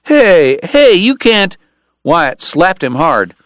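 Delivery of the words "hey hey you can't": higher, louder flustered protest; a shamed Texan stammering back; embarrassed stammer. A narrator's reading. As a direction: sputter like a shamed bully. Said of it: "the Texan stammered".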